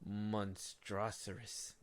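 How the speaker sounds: monstrosarous drawn out very slowly, syllable by syllable; stretched made-up word. Real, not acted. Dragging out monstrosarous to mock the movie. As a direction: savour monstrosarous slowly, like a freshly coined insult. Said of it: "I had to make up my own word for that shit".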